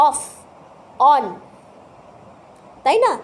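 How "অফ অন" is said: অফ and অন with a clear rounded o vowel, not আফ আন; careful teacher demo of অফ and অন; correct vowel demo. Shown as a demonstration. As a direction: say অফ, অন with a rounded o sound. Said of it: "আমরা আফ আন বলি না".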